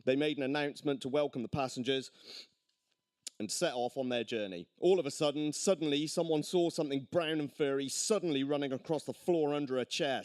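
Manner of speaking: usual speaking voice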